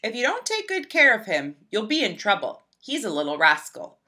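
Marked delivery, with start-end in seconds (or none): none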